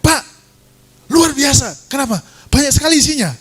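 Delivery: high-pitched amazed calling out; retold excited shout. Worker calls to pastor about the full account, excited high shout. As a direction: cry it out high, astonished. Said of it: "dia teriak lagi".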